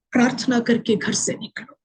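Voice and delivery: soft, hushed plea to pray before leaving home; earnest whisper. Real, not acted. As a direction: whisper it like a tender warning. Urging viewers to pray. (धीमी फुसफुसाहट में प्रार्थना की सीख)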